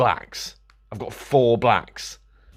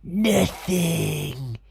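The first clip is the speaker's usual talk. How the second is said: much higher, whimpering mock sob; mock crying; 11 semitones higher, much slower than usual. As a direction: wail it in fake despair. Comic mock despair.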